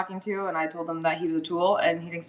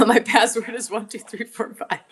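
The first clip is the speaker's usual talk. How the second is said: laughing; 4 dB louder than usual. Real, not acted. Laughs at blurting her password.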